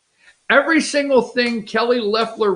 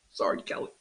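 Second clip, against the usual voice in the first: whispered, almost breathless sorry; whispered aside; 13 dB quieter, much faster than usual. Pretend. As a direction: slip the apology out under your breath. Mock apology to rival candidate.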